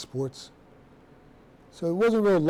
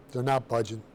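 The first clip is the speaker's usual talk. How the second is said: ordinary talk, nothing marked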